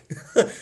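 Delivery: a quick giddy chuckle; patient chuckling with relief; short relieved laugh. Acted. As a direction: let a little laugh escape.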